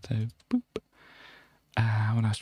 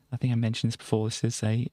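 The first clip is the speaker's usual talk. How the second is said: ordinary talk, nothing marked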